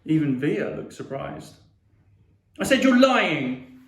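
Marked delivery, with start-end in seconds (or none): 2.6-3.8 high, loud, drawn-out shout; shouted accusation. A narrator's reading. Boy accusing Mum and sister, shouting. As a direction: shout it to be heard. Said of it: "I shouted".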